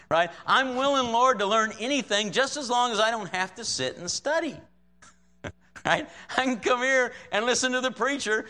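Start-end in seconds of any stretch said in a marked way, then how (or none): 0.5-4.5 high-pitched, bargaining plea; mock-earnest offer to the Lord; mock willing prayer. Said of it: "how many times I pray". 6.4-8.4 high-pitched, lightly acted self-justifying plea; mock-earnest churchgoer; mock excuse-making prayer. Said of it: "how many times I pray".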